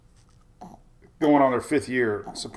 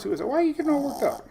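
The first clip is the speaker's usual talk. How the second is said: real high-pitched parent voice; 13 semitones higher, 4 dB quieter, much faster than usual. Real, not acted. Cooing at his fussing baby.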